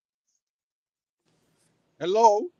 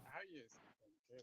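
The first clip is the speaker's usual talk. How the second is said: barely audible; 3 semitones lower, 18 dB quieter than usual. Real, not acted. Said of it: "I can barely hear you".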